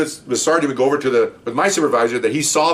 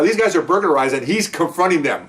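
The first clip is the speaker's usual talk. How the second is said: usual speaking voice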